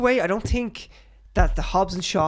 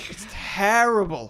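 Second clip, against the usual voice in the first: slow, drawn-out disdain; exasperated verdict; 3 semitones higher, slower than usual. Real, not acted. Condemning the film's Irish accent, exasperated. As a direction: stretch the word in dismay.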